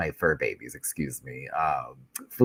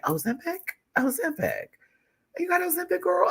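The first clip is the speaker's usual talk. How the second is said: very high teasing questioning voice; Mo Hart. Retold or imitated. Friend teasing creator about weight loss, high-pitched questioning. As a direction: imitate a friend's squeaky, nosy questioning. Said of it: "Mo Hart was like".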